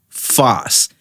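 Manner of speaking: "fast" as a drawn-out "fahs"; Patois pronunciation. Shown as a demonstration. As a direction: say "fast" the Jamaican way, dropping the T. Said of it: "when you say fast like that".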